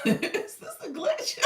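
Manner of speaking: high, loud question through laughter; helpless giggling on the words; laughing retelling. Real, not acted. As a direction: crack up while asking, drawn out. Laughs while retelling her confusion.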